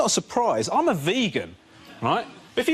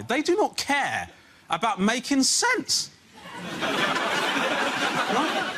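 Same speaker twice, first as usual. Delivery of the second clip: slow and emphatic; 6 semitones higher, much slower than usual. Real, not acted. Frustrated parent slowing down.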